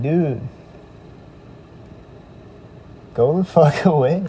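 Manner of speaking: mock angry dude-get-lost; retold snap. He finally tells the stalker off, fed-up mock yell.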